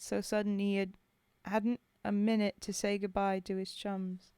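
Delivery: put-on poem read in a rough British soldier's accent. Streamer reading Bill's Grave to chat, in a British soldier's accent. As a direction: tell it as a homesick Tommy at the grave.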